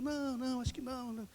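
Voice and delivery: a person brushing off the preacher, fading and unsure; reluctant refusal. Retold or imitated. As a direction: shrink away from the invitation. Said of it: "A pessoa".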